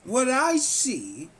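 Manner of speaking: slow, deliberate oratory with long pauses; monologue recitation. Acted. Reciting a self-written note about the country, solemn monologue recitation. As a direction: deliver it as a written piece, stretching key words and building in pitch.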